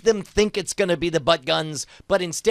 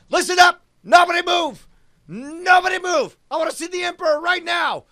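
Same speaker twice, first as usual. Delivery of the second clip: full-throated yelled threat, higher; hostage-taker shout; 10 semitones higher, 5 dB louder than usual. Acted. Captor yelling demands at the guards, furious shout. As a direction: bark orders over the crowd.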